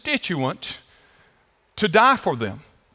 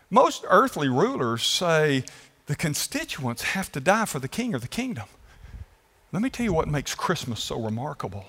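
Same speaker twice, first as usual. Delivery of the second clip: ordinary talk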